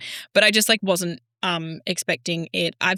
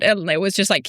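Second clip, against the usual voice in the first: usual speaking voice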